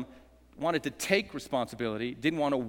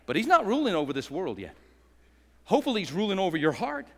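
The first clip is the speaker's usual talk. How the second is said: ordinary talk, nothing marked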